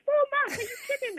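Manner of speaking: Four months?! as if it can't be true; shocked disbelief. Retold or imitated. Retelling shock at doctor.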